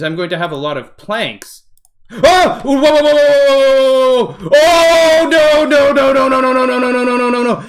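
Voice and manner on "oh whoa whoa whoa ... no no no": high, loud panicked cries; panicked screaming. Real, not acted. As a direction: yelp in sudden panic. Zombies attack the player.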